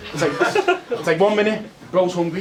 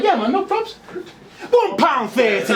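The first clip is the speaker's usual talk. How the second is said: high breezy reply; 6 semitones higher than usual. Retold or imitated. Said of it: "I'm like".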